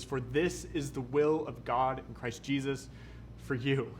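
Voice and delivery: slow, solemn reading of the verse; scripture reading. Acted. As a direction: lay each phrase down slowly, reverently. Reads the scripture verse aloud.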